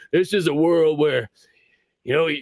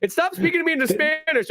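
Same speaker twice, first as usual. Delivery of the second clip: exasperated protest; 8 semitones higher than usual. Retold or imitated. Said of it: "I'm like".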